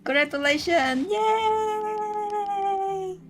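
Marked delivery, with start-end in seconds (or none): none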